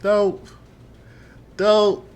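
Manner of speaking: pleading. Real, not acted. Begging opponent not to ban.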